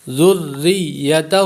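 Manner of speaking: ذریتہ recited slowly with zal clearly joined to the doubled ra and ya; ذریتہ صحیح تجوید کے ساتھ; correct tajweed. Shown as a demonstration. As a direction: join zal, ra and ya audibly in ذریتہ. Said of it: "نرمی سے پڑھیں گے".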